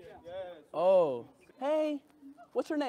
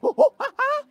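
high, loud laughing words; 10 semitones higher, 6 dB louder than usual. Real, not acted. Laughing loudly while watching the monkey.

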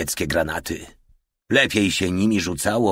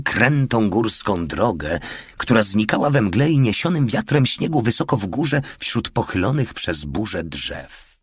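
usual speaking voice

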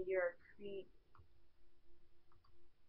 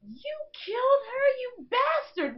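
much higher, loud accusing yell; furious shout; 14 semitones higher, 7 dB louder than usual. Acted. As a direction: scream it at the killer. Character accuses the killer.

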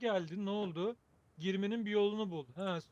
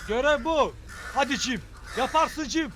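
hyped coaching; 4 semitones higher, 6 dB louder than usual. Acted. Cheering on his character while fleeing.

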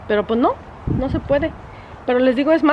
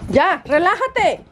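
commanding the dog; 7 semitones higher, much slower than usual. Real, not acted. Dog jumping, owner commands.

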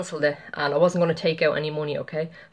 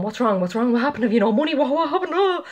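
rising, higher anxious questions, each one more urgent; worried friend asking; 7 semitones higher, faster than usual. Retold or imitated. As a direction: pepper her with worried questions, climbing higher. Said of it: "my friend and my son was standing there going".

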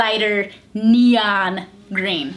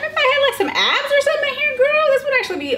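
much higher, giddy fantasy; high excited voice; faster than usual. Real, not acted. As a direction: gush giddily about the idea. Imagining having abs.

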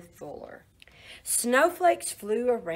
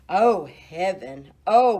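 ordinary delivery, nothing marked

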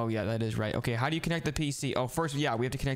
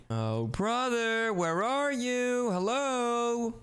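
calling out; 8 semitones higher, much slower than usual. Acted. Searching the house for brother.

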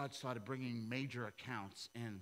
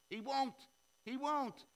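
high and loud insistence on won't; insistent repeated won't; emphatic repeat; 14 semitones higher, 4 dB louder than usual. Acted. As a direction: drive home won't twice. Preacher emphatic repetition.

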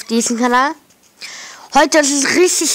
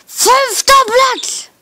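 high, slow, drawn-out joyful shriek; 13 semitones higher, 5 dB louder, slower than usual. Real, not acted. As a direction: scream the rank out in triumph. Reaches fifth place, repeated louder.

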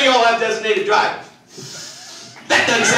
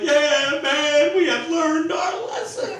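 high, drawn-out fake remorse; hammed-up sad drinker; mock sad; 8 semitones higher, 3 dB quieter, much slower than usual. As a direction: pretend to be sorry. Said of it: "you look real sad, like".

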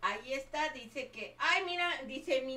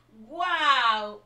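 loud, delighted cry of wow; excited wow; 14 dB louder than usual. Real, not acted. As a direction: burst out at the surprise gift. Gift pops up on stream.

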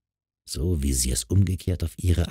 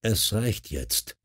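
slow, soft man's interruption; dark male voice; 2 semitones higher, 8 dB quieter, much slower than usual. A narrator's reading. Stranger interrupts the wife's phone call, dark and quiet. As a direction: cut in quietly, slowly, as a dark-voiced man. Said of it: "unterbrach eine dunkle Männerstimme".

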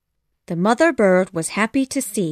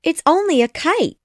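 put-on much higher girl character voice; Wendy; 9 semitones higher than usual. A narrator's reading. Wendy answers Peter on the rock, high girl voice. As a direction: pitch it high for the girl's part.

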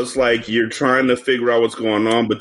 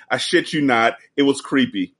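ordinary delivery

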